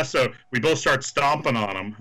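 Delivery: normal talking voice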